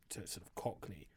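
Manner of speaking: usual speaking voice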